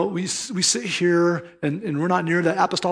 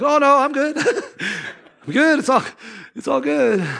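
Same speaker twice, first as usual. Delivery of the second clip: high, louder, panicky backing off with a laugh; nervous backpedaling student; 10 semitones higher, 4 dB louder than usual. Retold or imitated. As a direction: hastily take it all back, squeaky and nervous. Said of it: "And Lavery's like".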